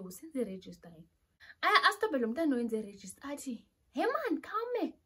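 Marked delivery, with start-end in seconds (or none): 3.9-4.9 high, drawn-out challenge; indignant interruption. Retold or imitated. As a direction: stop someone in their tracks, pitched high. Acting out the woman's challenge.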